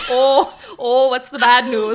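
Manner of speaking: loud mock Minnesota accent. Mimicking the co-host's line back, Minnesota accent. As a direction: parody a worried Minnesotan, loudly. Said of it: "like, a Minnesota accent".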